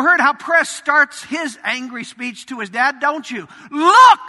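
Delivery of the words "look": sharp loud rebuke, pitched up. Retold or imitated. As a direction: bark it at your father. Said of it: "You can almost see his finger wagging".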